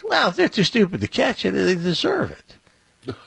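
high, sneering imitation of the boss; mocking sneer. Imitating the boss shrugging off his cheating, sneering and high.

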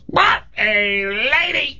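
very high, drawn-out parrot call; long shrill heckle from a cartoon bird; squawking parrot. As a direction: screech it slowly like a parrot. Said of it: "the parrot goes".

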